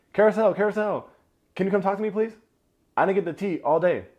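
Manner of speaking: high, loud mock gossip-seeker; calling a friend over. [name] breaking off her serious talk for gossip, calling out high and loud. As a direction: mimic an eager, high-pitched summons across the room.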